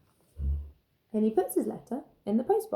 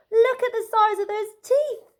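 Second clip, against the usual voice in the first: much higher and louder, wide-eyed gasp; excited gasp; 12 semitones higher, 8 dB louder than usual. A narrator's reading. Max gasping at the dinosaur teeth, awed. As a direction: gasp it out in awe. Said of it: "gasped Max".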